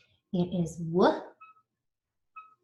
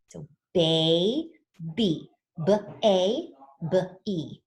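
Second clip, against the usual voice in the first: put-on baby stretched slowly; slow sounding out; much slower than usual. Shown as a demonstration. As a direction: model baby slowly for the class. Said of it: "try to sound it out".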